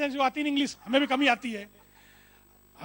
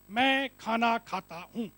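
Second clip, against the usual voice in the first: word by word, gaps between the words; slow demonstration; 2 semitones lower, much slower than usual. Shown as a demonstration. Speaker showing listeners a sentence pattern, slow word-by-word delivery. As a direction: lay out each word slowly and separately.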